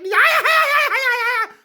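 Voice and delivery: long, high, wailing cackle; hysterical nervous laughter. Acted. Subway man being threatened, long hysterical cackle. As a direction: cackle loudly until it nearly turns to crying. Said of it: "he laughs when he's nervous".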